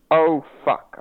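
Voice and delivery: sudden pained curse; stubbed toe. Real, not acted. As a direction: yelp from hitting a toe. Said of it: "I stubbed my toe".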